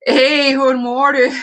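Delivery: high, loud Swedish lilt; playful, put-on Swedish greeting; attempted Swedish. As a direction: try on a Swedish accent for a greeting. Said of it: "I'm not good in Swedish".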